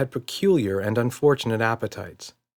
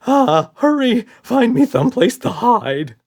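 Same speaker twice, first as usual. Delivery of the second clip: much higher, loud frantic cry; panicked shout; 9 dB louder than usual. A narrator's reading. Red begs to hide from the dad, frantic shriek. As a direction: shriek for cover.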